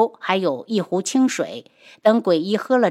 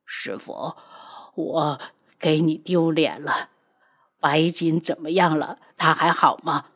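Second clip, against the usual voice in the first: low and soft, shamed; a contrite disciple to his master, quiet and low; apologetic; 4 semitones lower, 4 dB quieter than usual. A narrator's reading. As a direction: hang your head as you say it. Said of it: "他歉意的道".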